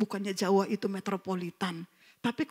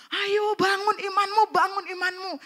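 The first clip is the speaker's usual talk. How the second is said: urging; 10 semitones higher than usual. Retold or imitated. Quoting friend urging him on.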